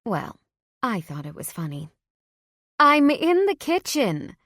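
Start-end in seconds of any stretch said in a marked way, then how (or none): 2.8-4.4 mom calling out. Acted. Mom calls from the kitchen.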